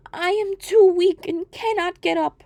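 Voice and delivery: put-on a sick old woman calling from bed; weak old grandmother. Grandmother calls out.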